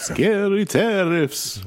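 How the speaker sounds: high, drawn-out spooky voice; hammed-up "ooh, scary" delivery; mock-scary. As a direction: play up pretend dread, high and stretched out. Mock fright at tariffs.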